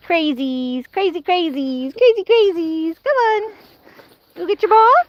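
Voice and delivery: squealing go-get-it encouragement; excited hype-up. Real, not acted. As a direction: whip the dogs into a frenzy. Hyping dogs for ball.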